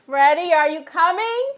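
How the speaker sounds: calling loudly to someone in another room. Real, not acted. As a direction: call out across the house. Calling husband from the kitchen.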